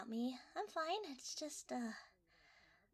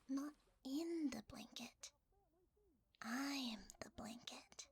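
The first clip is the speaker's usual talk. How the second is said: eerie whisper. Acted.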